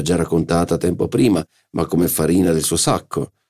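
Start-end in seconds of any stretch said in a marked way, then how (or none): none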